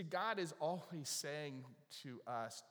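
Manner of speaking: ordinary talk, nothing marked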